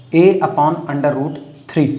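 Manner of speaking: slow, spelled-out formula; slow dictation. Shown as a demonstration. Teacher dictating a formula, slow and deliberate. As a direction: read the formula slowly for notes. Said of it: "dictating formula".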